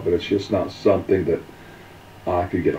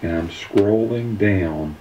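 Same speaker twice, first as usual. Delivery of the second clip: usual speaking voice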